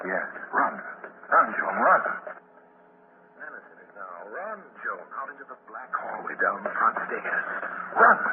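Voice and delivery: urgent narrator driving the chase; dramatic narration. A narrator's reading. Narrator urging Joan to run through the dark, urgent. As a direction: press the listener into the flight.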